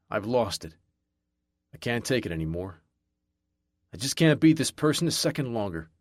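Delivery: usual speaking voice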